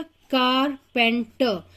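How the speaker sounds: Carpenter slowly in three separate pieces, कार, पेन, टर; split into syllables. Shown as a demonstration. Teacher showing pupils the pieces, carpenter broken into three syllables. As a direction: pause between each syllable of carpenter. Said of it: "आप हर टुकड़े में देखेंगे".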